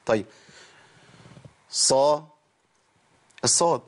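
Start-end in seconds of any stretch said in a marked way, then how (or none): 1.7-2.3 صا with heavy emphatic ص, tongue back raised; emphatic Saad. Shown as a demonstration. Teacher models صا for students, emphatic and heavy. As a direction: say صا thick and full, not سا. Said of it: "ما أقولش سا".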